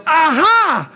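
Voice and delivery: imitating the wife's triumphant aha; mock gotcha. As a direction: pounce like you caught him. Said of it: "catch me and go".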